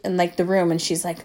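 normal talking voice

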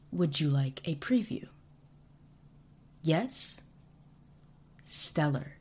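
ordinary talk, nothing marked

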